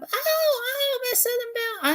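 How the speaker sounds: squealing high puppet cry; Minnie the puppet. Acted. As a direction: shriek the name in a tiny puppet voice. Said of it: "she's my puppet".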